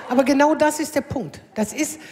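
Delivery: ordinary talk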